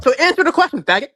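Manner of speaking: high-pitched, worked-up demand; hyped up. Real, not acted. Debater demanding an answer with an insult, hyped up. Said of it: "Dude, he's so hyped up".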